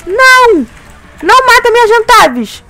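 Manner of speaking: high, frantic panic; panicking gamer. Real, not acted. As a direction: yelp in real panic. Boss attacks, near death.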